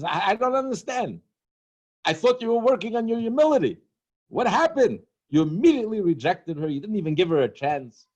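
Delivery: a matchmaker at his wit's end; hammed-up exasperated matchmaker; flustered exasperation. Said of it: "He's so flustered".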